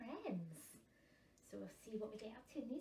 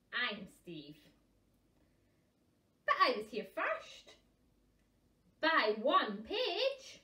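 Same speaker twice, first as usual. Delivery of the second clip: louder, slow, stubborn puffin; insistent puffin; 10 dB louder, much slower than usual. Acted. Second puffin arguing he came first, stubbornly slow. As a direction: drag out the claim proudly.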